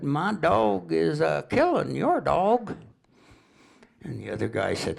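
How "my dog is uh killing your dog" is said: very slow, high Southern drawl; deadpan Tennessee drawl. Retold or imitated. Stranger breaks the news in a slow Tennessee drawl. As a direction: lay it out calm and drawn out, Tennessee style. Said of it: "they talk kinda slow and drawl down there".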